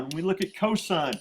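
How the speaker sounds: normal talking voice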